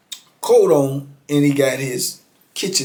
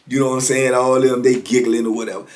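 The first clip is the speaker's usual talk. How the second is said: plain reading voice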